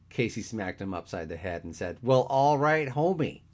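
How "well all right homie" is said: loud, high mock-cool comeback; mock retort. A narrator's reading. Casey smacking his teasing friend, loud mock retort. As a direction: throw it back at him, raised and loud.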